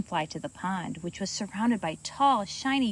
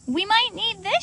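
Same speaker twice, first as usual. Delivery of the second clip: excited; 10 semitones higher, 4 dB louder than usual. Acted. Character's excited thought.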